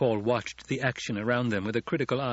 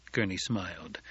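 plain reading voice

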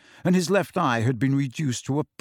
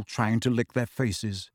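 ordinary delivery

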